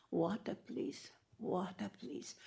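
weak, pleading plea for water; barely voiced, fading begging; faint begging. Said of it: "very fo-, faint voice".

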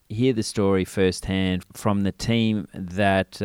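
normal talking voice

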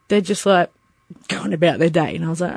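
ordinary talk

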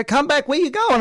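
high, mock call after someone running off; teasing shout after a retreating man. Calling after fleeing supervisor.

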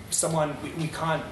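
normal talking voice

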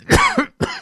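sharp sudden cough; coughing fit. Real, not acted. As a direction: cough hard. Coughs mid-sentence, excuses self.

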